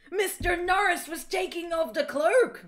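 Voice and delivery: high, loud mock-shocked cry; dramatic reveal. A narrator's reading. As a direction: shout it through laughter like a huge twist. Melodramatic reveal read aloud.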